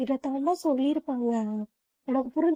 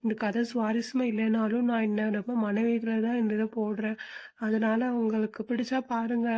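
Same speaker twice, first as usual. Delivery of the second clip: ordinary talk, nothing marked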